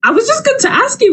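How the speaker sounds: ordinary talk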